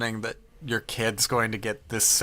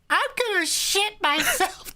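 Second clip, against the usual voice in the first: put-on much higher, slow little-kid voice; child voice. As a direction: whimper it like a small child. Imitating child.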